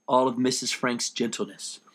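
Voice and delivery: usual speaking voice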